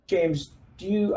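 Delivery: ordinary talk, nothing marked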